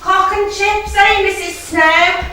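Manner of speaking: high, loud cheeky call; cheeky shout. Retold or imitated. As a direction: shout the order back cheekily. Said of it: "Mum would shout".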